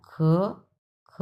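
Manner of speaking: "খ" repeated alone with pauses; isolated, deliberate "খ"; one syllable at a time. Shown as a demonstration. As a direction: drill "খ" by itself. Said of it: "প্রথমে আমরা বলবো খ".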